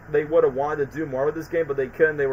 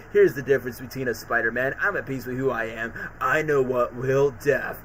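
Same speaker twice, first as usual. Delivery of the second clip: lower, softer sneering villain; sinister villain; 4 semitones lower, 4 dB quieter than usual. Retold or imitated. Dying Cletus taunting Spider-Man, lightly voiced sinister. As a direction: taunt him from the ground.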